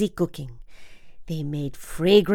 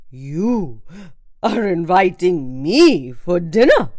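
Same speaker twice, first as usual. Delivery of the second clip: surprised; 6 dB louder than usual. A narrator's reading. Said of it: "almost fell off his elephant in surprise".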